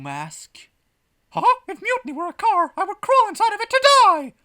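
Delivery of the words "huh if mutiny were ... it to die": very high, loud bellow; bellowing parade fan. Acted. Man in the parade crowd declares love of mutiny, bellowing. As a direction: bellow your devotion over the crowd. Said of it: "bellows a man".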